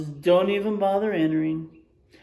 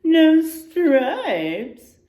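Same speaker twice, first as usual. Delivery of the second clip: whinnying zebra; 8 semitones higher, 9 dB louder than usual. A narrator's reading. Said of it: "whinnies Pundamilia the zebra".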